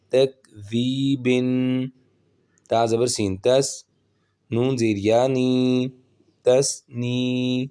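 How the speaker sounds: slow, measured syllable-by-syllable drill; spelling-drill recitation. Shown as a demonstration. Quran teacher spelling words for learners, measured recitation. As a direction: draw out the long syllables evenly.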